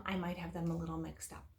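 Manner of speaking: ordinary talk, nothing marked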